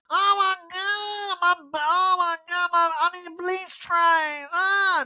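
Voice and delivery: high, slow hammed-up freak-out; Erica. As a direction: wail it in a drawn-out falsetto. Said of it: "Erica's like".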